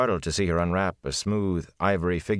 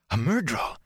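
higher startled exclamation; exclaimed; 6 semitones higher than usual. A narrator's reading. Rand startled by the warder's word, exclaiming. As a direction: blurt it in alarm. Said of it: "Rand exclaimed".

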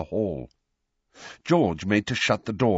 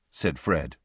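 ordinary delivery, nothing marked

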